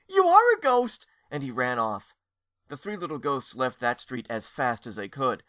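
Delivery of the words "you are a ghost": very high, loud shocked cry; boy crying out on discovering a ghost. Acted. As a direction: realize it and cry out.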